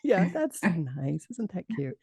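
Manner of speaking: mock the nurse's brush-off, drawn out; sarcastic mimic. Retelling how the nurse dismissed her, slow sarcastic echo.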